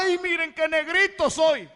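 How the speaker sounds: high, loud, slow self-pitying whine; mocking someone pitying himself, slow high whine; mock whining. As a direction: pout and whine it. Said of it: "va a ponerse a llorar".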